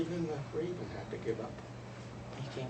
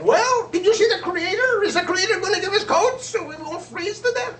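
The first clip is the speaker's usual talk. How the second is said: much higher and louder, eager chattering birds; 12 dB louder than usual. Acted. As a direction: crowd of birds crying out for news. Birds eagerly question returning Seagull.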